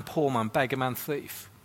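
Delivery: usual speaking voice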